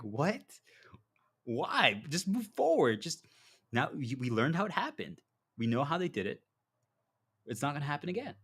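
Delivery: high, loud, incredulous pep talk; exasperated urging. Acted. Teacher urging stuck disciples to move forward, loud exasperation. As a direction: push them insistently to move on.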